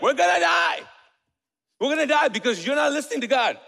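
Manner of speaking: loud, frantic cry of terror; panicked disciples. Retold or imitated. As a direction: yell it like the boat is sinking. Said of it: "they're like".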